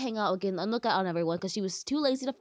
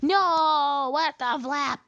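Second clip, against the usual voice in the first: loud, higher-pitched outburst; exasperated yell; 4 semitones higher, 7 dB louder, slower than usual. Real, not acted. Player losing at the flapping game, exasperated yell. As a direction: yell out in defeat.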